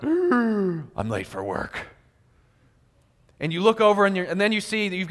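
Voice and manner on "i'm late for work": slow, dragged-out dismay at oversleeping; mock alarm at being late; panicked realization. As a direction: let the dread sink in slowly. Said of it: "and you're like".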